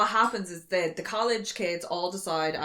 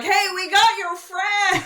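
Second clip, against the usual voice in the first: high, loud, slow goofy greeting; hammed-up friendly hillbilly; mock cheery hillbilly; 13 semitones higher, 6 dB louder, much slower than usual. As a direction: call it out too cheerfully. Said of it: "they're like".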